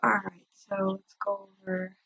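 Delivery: usual speaking voice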